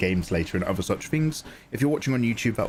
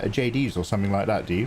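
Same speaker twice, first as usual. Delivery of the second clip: ordinary talk, nothing marked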